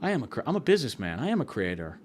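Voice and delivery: low, soft stoned mumbling to oneself; mildly dazed, hushed self-affirmation; imitated stoned agreement. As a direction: play lightly high, quietly convincing yourself. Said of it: "just high as hell".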